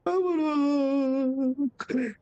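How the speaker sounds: very high, drawn-out squeaky whine; mock whimpering plea. Man imitating himself wrapped in a blanket on the sofa, mock whimpering plea. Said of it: "wrapped up in my blanket going".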